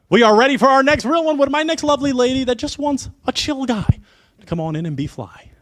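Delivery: high, loud game-show call-in; announcer intro. Acted. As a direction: belt out the next contestant's entrance, easing off at the end. Host announces next contestant.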